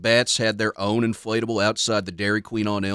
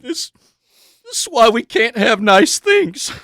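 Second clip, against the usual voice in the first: high, slow anguished wail; 13 semitones higher, 7 dB louder than usual. Acted. Sportscaster wailing over a crippled player, anguished. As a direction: howl it out in mock agony. Grief-stricken sportscaster wails.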